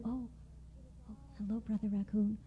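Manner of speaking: barely audible frightened reply; timid mouse. Acted. Startled little mouse answering Raccoon, very soft and timid. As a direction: shrink away as you speak.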